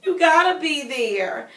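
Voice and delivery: high, slow pleading with a laugh; half-laughing plea, as if about to cry; mock-hurt protest to her husband; near tears. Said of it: "I kinda almost wanted to cry".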